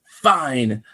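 a huffy "fine", imitating a tantrum; mock a petulant character giving in; fake temper tantrum. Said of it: "dramatic fake temper tantrums".

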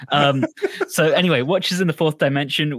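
usual speaking voice

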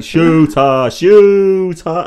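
loud, rising "shooter" said twice; quoted film line. Retold or imitated. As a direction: deliver "Shooter, shooter" with mounting menace. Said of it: "It's like".

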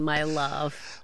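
normal talking voice

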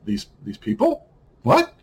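disbelieving high squeak, slow on people; mock shocked what; incredulous. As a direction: pitch shoots way up in disbelief. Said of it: "I'm like".

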